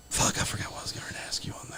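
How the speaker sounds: breathless fast whisper into the mic. Real, not acted. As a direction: whisper it quickly, no voice. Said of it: "You know you're whispering into the mic".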